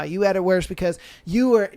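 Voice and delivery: ordinary talk, nothing marked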